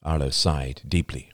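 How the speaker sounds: usual speaking voice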